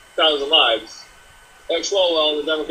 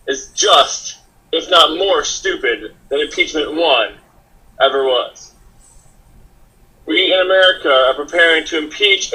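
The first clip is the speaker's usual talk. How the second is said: louder, deliberate declamation with weighted pauses; opening monologue; 5 dB louder than usual. Acted. Host opening the show on a second impeachment, declamatory monologue. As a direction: declaim it like a statement for the record.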